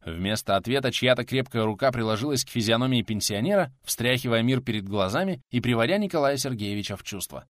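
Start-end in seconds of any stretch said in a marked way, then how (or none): none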